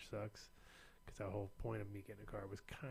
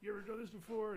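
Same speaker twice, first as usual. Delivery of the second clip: mock TSA agent; 10 semitones higher than usual.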